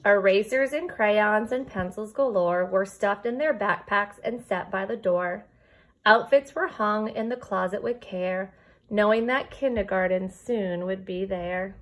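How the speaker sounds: lilting storybook read-aloud; reading to children. Acted. Teacher reading a rhyming book to her kindergarten class, storybook lilt. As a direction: read the rhyme to your class, gently bouncing.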